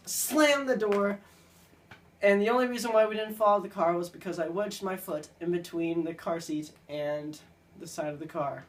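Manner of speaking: ordinary talk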